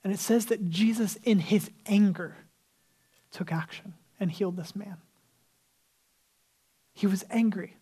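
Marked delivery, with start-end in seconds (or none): 3.3-5.0 whisper. Acted. Preacher drops voice for climax.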